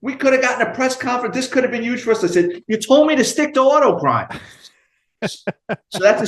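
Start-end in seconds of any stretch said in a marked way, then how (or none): 0.0-2.2 much higher, fast mock rant; imitating the lieutenant's riot act; scolding. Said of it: "starts reading me the riot act". 2.7-4.3 indignant retort. Retold or imitated. Retorting to the angry lieutenant.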